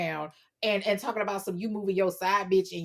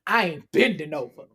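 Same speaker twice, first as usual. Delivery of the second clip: much lower, firm male voice; man's firm denial; 7 semitones lower than usual. Retold or imitated. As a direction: deny it with conviction. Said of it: "he said it with conviction".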